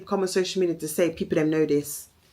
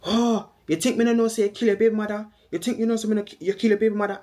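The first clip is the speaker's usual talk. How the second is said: aunt's accusation; 4 semitones higher, faster than usual. Retold or imitated. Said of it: "And Angie said".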